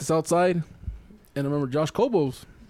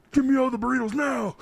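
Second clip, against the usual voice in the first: higher-pitched mock hold-up order; mock demand; 7 semitones higher than usual. Customer prank-demanding all the burritos, mock commanding. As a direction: bark it like a joke robbery. Pretend stick-up at food counter.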